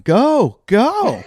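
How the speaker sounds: mock urgent shout. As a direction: call out as if shooing someone onward. Acting guard urging him onward.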